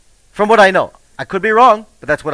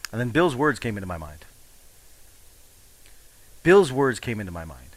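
ordinary talk, nothing marked

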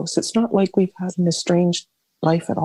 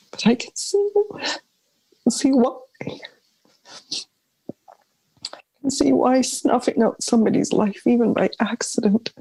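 high, strained voice close to tears; fighting back tears as she speaks; voice breaking; 5 semitones higher than usual. Real, not acted. Daughter forgiving her mother, voice breaking with tears.

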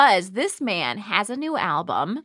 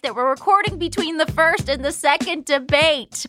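show host intro; 5 semitones higher than usual. Acted.

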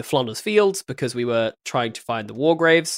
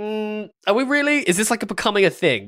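skeptical; 8 semitones higher than usual. Retold or imitated. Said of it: "I'd be like".

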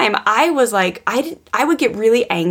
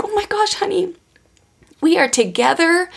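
tender comforting; 10 semitones higher than usual. Retold or imitated. Mother comforting daughter.

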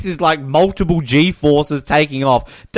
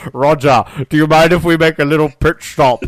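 Sean Connery voice. Retold or imitated. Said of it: "Sean Connery's stowed away".

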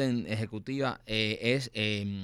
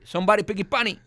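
mock firefighter; 6 semitones higher, 3 dB louder than usual. Firefighter answering the caller.

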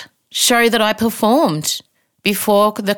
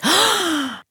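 dramatic gasp; 10 semitones higher than usual. Real, not acted. Therapist's words land hard.

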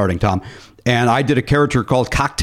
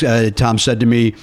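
usual speaking voice

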